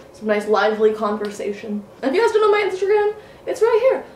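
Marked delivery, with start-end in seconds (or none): 2.0-4.0 fast high plug. Real, not acted. Quick promo of her Instagram.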